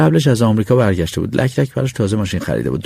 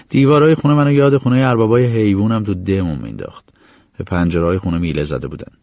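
ordinary delivery

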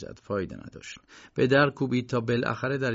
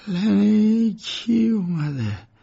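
put-on raised old man's voice, drawn out; an ailing old man calling from bed; sick old lawyer; 9 semitones higher, 4 dB louder, much slower than usual. A narrator's reading. (پیرمرد بیمار با کلام کشیده)

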